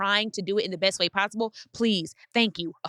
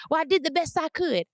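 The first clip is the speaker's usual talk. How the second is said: higher, a hint of a wounded defensive parent; 8 semitones higher than usual. A parent hearing their child's hurt, lightly defensive. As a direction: lightly play the parent pushing back.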